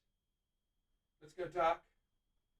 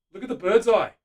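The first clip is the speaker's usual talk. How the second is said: louder, higher and thrilled; excited admiring; 6 semitones higher, 21 dB louder than usual. Real, not acted. Painter showing viewers the bird's eye, thrilled. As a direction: show it off with delight.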